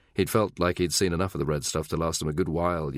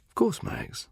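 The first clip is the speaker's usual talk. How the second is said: suddenly playful and coy; kittenish. Acted. Secretary softening toward her flirting boss, kittenish. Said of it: "suddenly kittenish".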